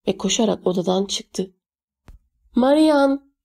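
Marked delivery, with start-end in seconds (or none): 2.6-3.3 higher-pitched but contained call; lightly acted shout after someone; narrated shout. A narrator's reading. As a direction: call the name out as a mild yell. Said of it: "diye bağırdı babam tekrar".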